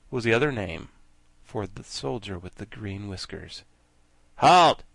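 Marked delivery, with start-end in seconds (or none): 4.4-4.8 loud military halt; cried out. Acted. The soldier halts the girl army, loud cry. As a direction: stop them with one loud order. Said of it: "he cried".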